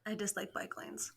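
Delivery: hushed mock confession; whispering. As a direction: whisper it like a dark secret.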